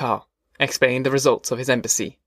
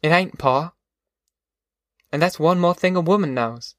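higher-pitched, steady motherly voice; reassuring country mother; 4 semitones higher than usual. Acted. Ma explaining to Pa how women see life, higher reassuring voice. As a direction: gently set him straight in a raised mother's voice.

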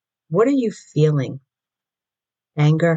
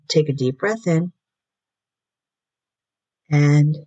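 guided meditation. Acted. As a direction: ease them into the present moment. Leads a short meditation.

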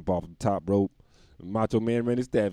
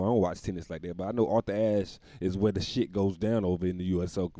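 normal talking voice

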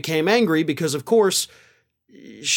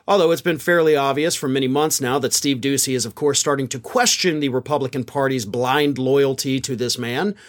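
ordinary talk, nothing marked

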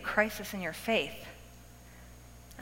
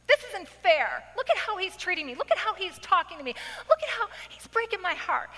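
much higher, hammed-up whining complaint; mock self-pity; 8 semitones higher than usual. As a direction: whine like a wronged wife playing the victim. Acting out self-pitying complaint.

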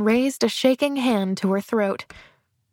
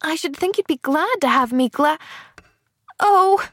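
put-on higher child voice, cut short mid-word; breaking off; 7 semitones higher than usual. A narrator's reading. Pollyanna catches herself saying the banned word, startled. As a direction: stop dead, then a high, startled oh. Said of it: "she broke off".

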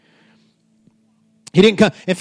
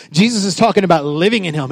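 ordinary talk, nothing marked

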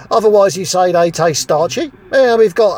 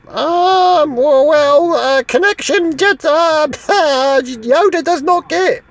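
higher put-on Yoda, backwards phrasing; higher little-master voice with a laugh in it; mock Yoda voice; 5 semitones higher, slower than usual. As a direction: mimic the Jedi master to mock him. [name] mimics Yoda's reply.

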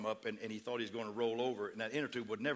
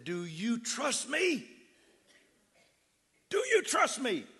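high, slow, loud demand; urgent shout; 11 semitones higher, 7 dB louder, much slower than usual. Retold or imitated. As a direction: yell the question to break his panic. Pastor shouting at drowning man.

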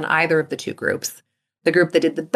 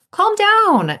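mock calm down; 13 semitones higher than usual. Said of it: "if somebody tells you".

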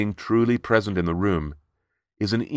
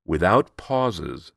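slow; much slower than usual. Shown as a demonstration. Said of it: "when you take away silence".

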